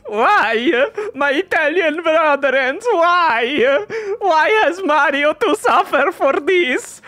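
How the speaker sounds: high, loud hammed-up lament with sobs; a hammed-up crying plea, pitched high; mock wailing. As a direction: wail it like a grieving Italian relative. Mock wailing at Italian dub.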